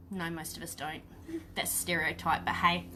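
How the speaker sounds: normal talking voice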